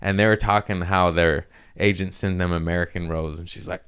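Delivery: ordinary talk, nothing marked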